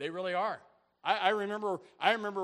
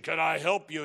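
lightly voiced gravelly attorney, louder; 7 dB louder than usual. Rough attorney receiving a new client, lightly gravelly. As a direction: put on a hint of gravel, loudly. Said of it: "gravelly kind of a voice".

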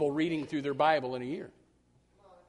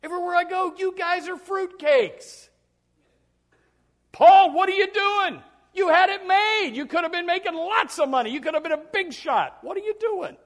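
mocking Festus; 13 semitones higher, 7 dB louder than usual. Preacher voicing Festus mocking Paul in high scorn. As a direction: scold him as a foolish fanatic. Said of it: "He said".

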